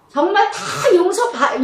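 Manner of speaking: usual speaking voice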